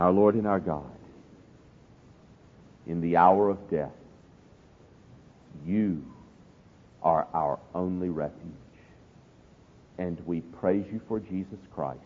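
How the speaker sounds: low, measured prayer with long pauses. Real, not acted. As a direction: pray solemnly, phrase by phrase, softening into the amen.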